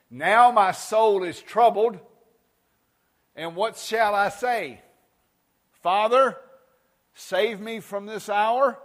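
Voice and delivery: slow, loud, high scripture reading; preaching scripture. Acted. Preacher reading Jesus' words to the church, slow and loud. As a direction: let the words of Jesus ring out slowly.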